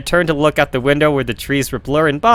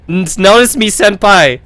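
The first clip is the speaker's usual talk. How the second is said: excited anime fan; 5 semitones higher, 5 dB louder than usual. Acted. Anime fan meme line.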